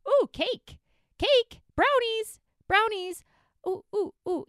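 high, loud, hammed-up craving; greedy food excitement. Dieter suddenly spotting cake and brownies, greedy excitement. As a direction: squeal over treats like a distracted dieter. Acted dieter distracted by treats.

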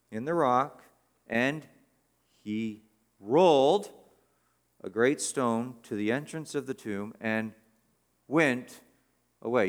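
each verb punched, slow, with pauses; stressing the verbs. Shown as a demonstration. Preacher reading the verse to his congregation, stressing each verb. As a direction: lean on every action word. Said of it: "offering a little bit of extra pronunciation to them, emphasis".

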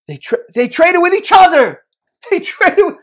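high and loud, bursting with sudden discovery; excited realization. A narrator's reading. Preacher telling how the father-in-law realizes, excited. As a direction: cry out as the penny drops.

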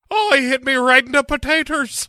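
high, loud hammed-up Irish leprechaun accent; mock leprechaun accent. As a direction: whine it like a comic stage Irishman. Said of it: "he doesn't sound like a leprechaun".

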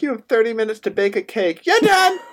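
high-pitched host's announcement bursting into a shout; Nicole Byer. Retold or imitated. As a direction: call time abruptly, loud and shrieky at the end. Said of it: "They pulled a Nicole Byer".

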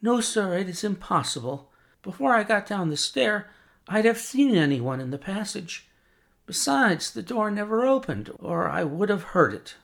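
high, earnest maid answering sir; lightly voiced young servant; anxious servant.

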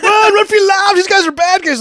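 high, loud hammed-up warning cry; cartoonish run-for-your-lives scream; mock panic yell. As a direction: shout it like fleeing in terror. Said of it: "He's like".

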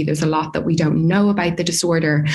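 ordinary talk

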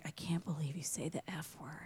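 put-on hushed, voiceless scandalised kids; whispered kids' shock. Imitating her students whispering in shock at her swearing. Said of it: "they're like".

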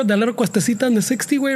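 normal talking voice

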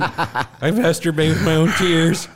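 hammed-up lovesick misery; mock heartbroken wail. Caller begging his ex, hammed-up heartbreak.

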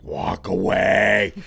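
slow, drawn-out low warning; urgent hungry-hiker voice. Retold or imitated. Wife sending husband away, slow and low. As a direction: stretch each word, deadly serious. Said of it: "It's the same voice".

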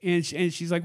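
ordinary delivery

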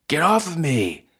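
high, loud outburst; angry struggle. Acted. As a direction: shout to shake someone off. Struggle over the bag.

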